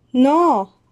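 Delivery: panicked refusal; a frightened scream. A narrator's reading. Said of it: "oyeron gritar a la profesora Umbridge".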